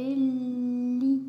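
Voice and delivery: "lit" with a long, held L; syllable demonstration. Shown as a demonstration. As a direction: draw out the sound of "lit". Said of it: "là, ça fait". (« lit » prononcé en allongeant le son)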